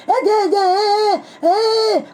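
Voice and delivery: high, drawn-out stammering imitation; hammed-up bewildered sputtering; mock stutter. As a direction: stammer up high, then wail the last syllable. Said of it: "وقعد يتأتأ ومبرق عينه".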